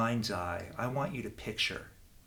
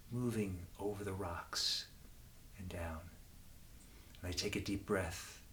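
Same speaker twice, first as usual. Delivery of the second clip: gentle, lower meditation voice with long pauses; guided meditation; 3 semitones lower, 5 dB quieter than usual. Acted. Leading children to their own safe garden place, guided meditation. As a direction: ease them softly into calm.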